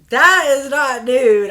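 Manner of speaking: loud, high outrage: that is not nude; indignant shout. Real, not acted. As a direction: blurt it out, fed up and loud. Indignant outburst at the product.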